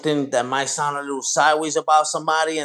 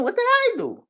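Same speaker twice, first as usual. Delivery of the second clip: very high, squeaky-pitched plea; mock protest. Streamer who can't win with critics, mock-wounded protest. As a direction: plead innocence way up high.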